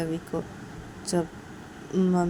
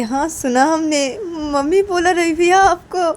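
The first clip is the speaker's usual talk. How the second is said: excited squeal; 10 semitones higher, 12 dB louder than usual. Acted. Rani excited baby spoke.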